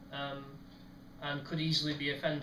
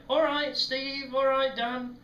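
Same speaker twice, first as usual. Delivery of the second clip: high, drawn-out, louder hail; mock passing greeting; 9 semitones higher, 6 dB louder, slower than usual. Steve and Dan passing on the coal, high mock greeting. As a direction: imitate friends calling out as they pass. Two friends greet while rolling past.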